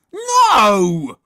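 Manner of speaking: full-blast high scream of refusal; Horrid Henry shriek. A narrator's reading. Boy rejecting the bunny film, shrieking. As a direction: explode in a drawn-out shriek. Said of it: "shrieked Horrid Henry".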